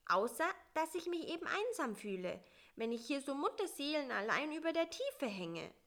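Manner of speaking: small, high and fast; a little fly pleading quickly. Acted. Bissi explains his loneliness, high and quick.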